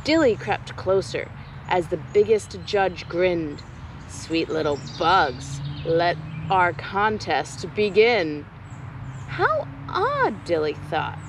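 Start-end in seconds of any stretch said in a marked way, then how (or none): 4.1-8.5 lightly voiced lower sly judge; sly spider judge. Judge lures the bugs. 9.3-10.4 much higher, puzzled small voice; curious little Dilly. Acted. Dilly thinks aloud.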